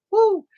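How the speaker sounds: high, loud "woo"; exhilarated whoop. Real, not acted. Recalling fire in her body, loud exhilarated whoop. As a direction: let out a sudden spirited whoop.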